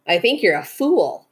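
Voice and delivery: usual speaking voice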